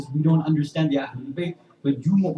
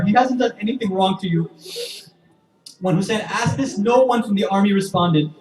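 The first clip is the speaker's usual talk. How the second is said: louder, higher preaching at full force, building grief; impassioned preaching; 9 semitones higher, 11 dB louder than usual. Acted. Preacher to the mourners, telling Ali al-Asghar's story, impassioned loud preaching. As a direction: drive the lament home to the congregation.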